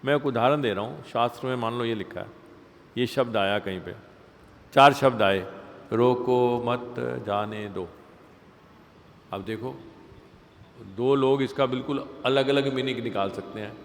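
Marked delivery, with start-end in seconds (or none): 5.9-7.9 slow, even quote; evenly spaced words. Shown as a demonstration. Teacher repeating four words found in scripture, evenly spaced. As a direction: draw out each word with no grouping.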